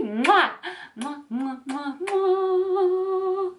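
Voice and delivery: high, sing-song air kisses; blowing kisses. Real, not acted. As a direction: smack kisses to the camera, playfully. Blowing kisses at video end.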